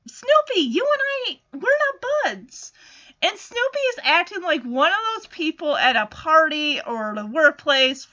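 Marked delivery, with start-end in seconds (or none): 0.1-2.7 higher, mildly irritated brush-off; a touch of annoyed big sister; lightly voiced irritated Lucy. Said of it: "And she's irritated".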